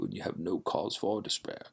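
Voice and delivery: put-on a gentle Dracula-style Eastern European accent; subdued Transylvanian accent; Dracula's accent. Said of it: "That's Dracula's accent".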